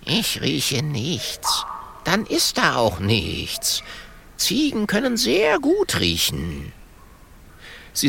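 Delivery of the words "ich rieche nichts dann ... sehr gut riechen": slow, drawn-out goat character; self-assured goat. Acted. Holunder dismisses Mathilda's worry, self-assured. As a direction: stretch the words like a know-all goat. Goat sniffs and answers porcupine.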